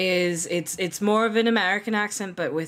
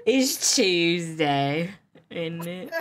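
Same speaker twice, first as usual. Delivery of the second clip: slow, stretched mock British accent; Tuesday voice; much slower than usual. Showing off the Tuesday voice, hammed-up British. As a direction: draw out TUESDAY in exaggerated English. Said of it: "I just went right to Tuesday voice".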